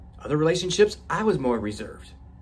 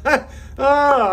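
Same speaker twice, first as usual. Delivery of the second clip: amused cry; 12 semitones higher, 6 dB louder than usual. Real, not acted. Laughing at own word slip.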